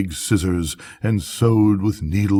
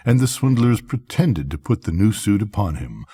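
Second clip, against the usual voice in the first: ordinary delivery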